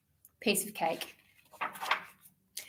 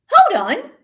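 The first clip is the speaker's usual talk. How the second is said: excited bird; 11 semitones higher, 9 dB louder than usual. Acted. Bird has idea.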